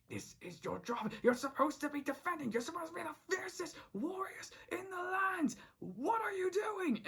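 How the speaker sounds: high, strained mock yell; imitated scolding of troops; mock screaming captain. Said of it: "he's still screaming at them".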